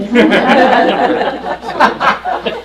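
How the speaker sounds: high, loud burst of laughter; cracking up at own punchline; real laugh. Real, not acted. Teacher laughing at own joke to class, real laughter.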